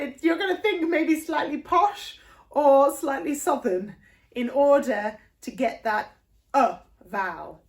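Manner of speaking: usual speaking voice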